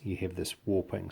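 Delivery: normal talking voice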